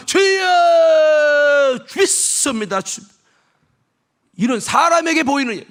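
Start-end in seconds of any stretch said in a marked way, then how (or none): 0.1-3.0 high, loud, hugely drawn-out 주여; showy shouted prayer. Retold or imitated. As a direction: cry out to be seen praying. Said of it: "사람 보면". (남에게 보이려고 크게 외치는 기도)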